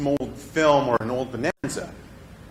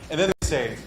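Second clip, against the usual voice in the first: normal talking voice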